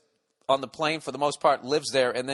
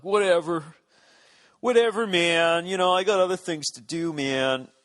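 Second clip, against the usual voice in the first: jaded; 3 semitones higher than usual. Retold or imitated. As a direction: shrug it off like a spoiled audience. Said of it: "all jaded".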